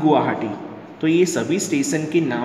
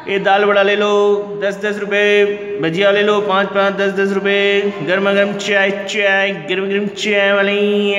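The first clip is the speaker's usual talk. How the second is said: higher, louder hawker's cry; vendor calling out; 5 semitones higher, 4 dB louder than usual. Pretend. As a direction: sing out snacks on the platform. Railway food vendor calling out.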